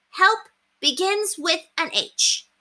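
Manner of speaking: put-on high, slow, proud child voice; slow, high voice of a pleased small girl; pleased little girl. A narrator's reading. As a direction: announce it like a proud discovery. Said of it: "pleased with herself".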